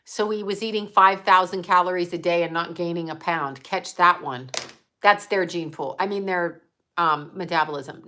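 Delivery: ordinary talk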